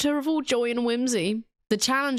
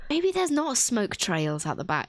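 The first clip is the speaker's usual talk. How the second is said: ordinary delivery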